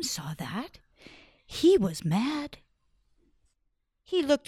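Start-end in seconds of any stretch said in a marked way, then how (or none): none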